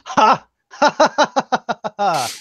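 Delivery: big hearty king laugh; booming laugh. Acted. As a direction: belt out a royal laugh. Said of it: "he's like".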